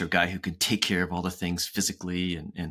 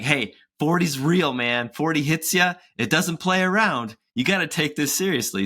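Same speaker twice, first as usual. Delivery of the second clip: Kevin Hart impression; 7 semitones higher than usual. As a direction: do Kevin Hart, warning earnestly. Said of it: "He's like".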